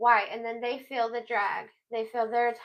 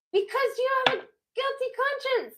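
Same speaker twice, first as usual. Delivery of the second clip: accusing; 13 semitones higher than usual. Acted. Pointed accusation, high and odd voice.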